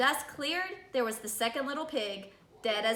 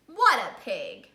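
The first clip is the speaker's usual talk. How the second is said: usual speaking voice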